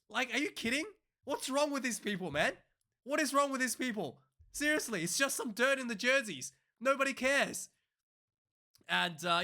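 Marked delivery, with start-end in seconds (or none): 0.1-7.7 pitched way up, incredulous and fed up; a high, loud-edged rant of pure disbelief; exasperated rant. Real, not acted.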